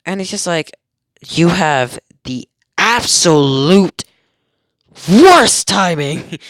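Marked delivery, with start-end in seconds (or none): 1.3-6.4 slow, drawn-out, louder on worst timing; exasperated. Acted. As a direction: groan it like fate is mocking you. Exasperated comment on the blade.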